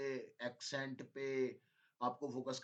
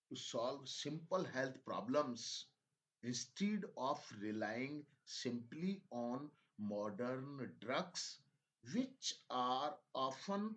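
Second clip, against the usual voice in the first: very slow, emphatic reading in separated phrases; dictation-style reading; 5 semitones higher, 3 dB louder, slower than usual. Shown as a demonstration. Teacher dictating a health passage to English learners, slow emphatic reading. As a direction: spell out the passage phrase by phrase, stretched and deliberate, each opening pitched up. Reads passage for listening practice.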